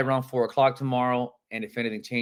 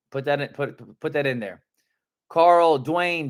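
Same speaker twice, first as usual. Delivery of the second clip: ordinary delivery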